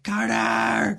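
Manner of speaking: high, loud pleading cry; desperate shout. Acted. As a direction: call after him as he leaves. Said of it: "just be like".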